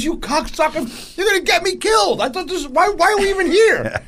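high-pitched retold tirade; exasperated mock fury, voice way up; furious. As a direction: act out a friend's panicked outrage at his buddies. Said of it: "so fucking mad at us".

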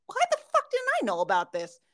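exasperated disbelief. Retold or imitated. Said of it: "And I'm just like".

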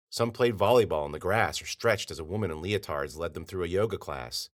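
ordinary delivery, nothing marked

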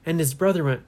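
ordinary delivery, nothing marked

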